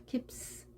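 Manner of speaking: "keeps" with a hissed, unvibrated s at the end; strong voiceless s. Shown as a demonstration. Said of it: "S도 떨리지 않게 강하게". ("keeps" 끝을 떨림 없는 스로)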